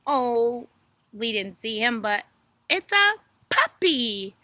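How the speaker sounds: ordinary talk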